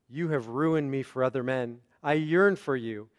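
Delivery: "ruined me for other men" as one phrase, then "I yearn for you" apart; devoted phrasing. Shown as a demonstration. Rabbi reading Jane's devotion to John, yearning phrasing. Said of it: "the periods went and the emphasis went".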